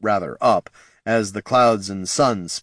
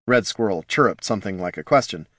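ordinary delivery, nothing marked

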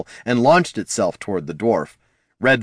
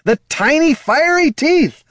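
very high, slow wail; drawn-out high-pitched alarm; panicked cry; 6 dB louder than usual. Acted. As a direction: wail each word in hammed-up horror. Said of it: "the bard cried".

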